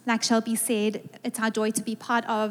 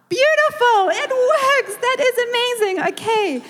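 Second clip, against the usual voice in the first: high-pitched, thrilled exclamation; excited, way up high; delighted praise; 11 semitones higher, 4 dB louder than usual. Real, not acted. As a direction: burst out delighted that it worked. Delighted that the congregation responded.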